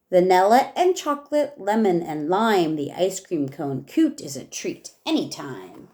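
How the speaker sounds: lively rhyming read-aloud for a class; reading to children. Acted. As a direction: read each verse with bounce for the kids. Teacher reads rhyming picture book aloud.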